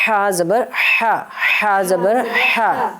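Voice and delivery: ہا drawn out slowly from the throat, breath let out gently; a slow teacher's model of the throat sound ہا; slow breathy ha demo. Shown as a demonstration. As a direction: stretch each ہا slowly, air easing out through the mouth. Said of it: "آہستہ آہستہ آپ نے اس کو نکالنا ہے".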